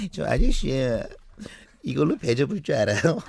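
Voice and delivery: put-on tipsy young woman slurring lightly; drunk voice. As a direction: play it lightly tipsy and odd. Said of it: "취한 목소리로".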